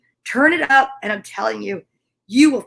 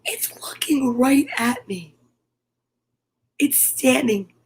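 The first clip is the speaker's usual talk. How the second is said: slow hushed whisper, almost no voice; 3 dB quieter, slower than usual. Real, not acted. To viewers while a bird watches her, hushed whisper. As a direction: breathe it so the bird stays put. Bird at window, not scaring it.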